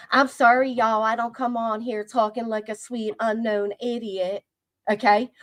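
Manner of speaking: normal talking voice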